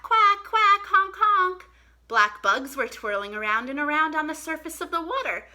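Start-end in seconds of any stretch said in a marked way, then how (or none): 0.0-1.7 loud high quacks and honks; calling back. Acted. Buddy calling back to the ducks, loud high quacks. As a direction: shout the duck calls back across the pond. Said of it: "Buddy called back".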